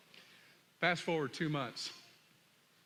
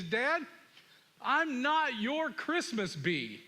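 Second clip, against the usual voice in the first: put-on raised, slow, emphatic teen defiance; retold angry outburst; 6 semitones higher, slower than usual. Son throws saw and defies his father, angry. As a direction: act out a fed-up son, each word spaced. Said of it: "the anger is built so much".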